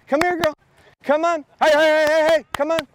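high coaxing call; bright dog-call. Real, not acted. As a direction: coax the dog back in. Calling the dog in.

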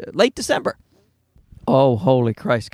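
normal talking voice

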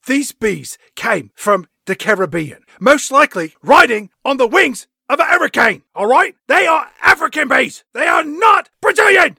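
building to a furious shout. Acted. Caine voiced, shouting about bees.

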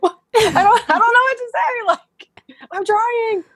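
high, giggly protest through laughter; laughing helplessly while insisting you're trying. Retold or imitated. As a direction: laugh it off, pitch way up. Laughing while recalling her parents' pressure.

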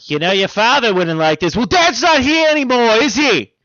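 high, loud Boston family squabble; Boston accent. Pretend. Mother and son arguing, loud Boston accent. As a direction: throw it back like a bitter Boston kid. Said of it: "we all sound like we're from Boston".

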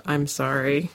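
normal talking voice

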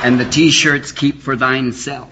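low, slow Hollywood-star drawl; Jack Nicholson. Acted. Imagining a film star as a great Hamlet, low slow drawl. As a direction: drawl the verse low and slowly. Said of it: "He'd be a great Hamlet, just like".

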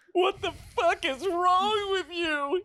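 very high, slow wail; high-pitched, drawn-out cry. A narrator's reading. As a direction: wail it in hammed-up disbelief. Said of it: "he cried".

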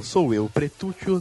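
ordinary talk, nothing marked

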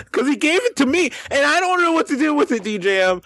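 very high, worked-up complaint; exasperated plea. Real, not acted. Man handing a bad joke to his co-host, helpless. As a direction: voice climbing high in comic helplessness.

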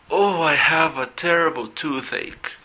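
acted pain.

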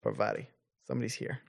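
guard speaking quietly into a handheld radio; quiet, into a communicator. Acted. Guard reports a visitor over the communicator, quietly into it. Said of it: "It's quieter. He's talking into it".

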